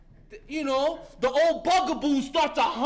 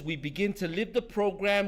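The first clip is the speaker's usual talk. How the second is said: ordinary talk, nothing marked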